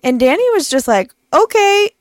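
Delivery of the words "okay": put-on high, chirpy little girl reply; bright child voice. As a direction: toss off a breezy kid's hi.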